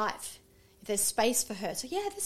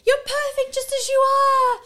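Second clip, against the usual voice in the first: cheering shout; 13 semitones higher, 9 dB louder than usual. Retold or imitated. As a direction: shout encouragement after the car. Mum cheering kids from the street.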